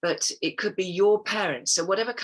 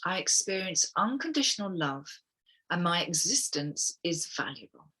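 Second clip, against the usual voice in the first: guided affirmation. Acted. Reads affirmations aloud to the group.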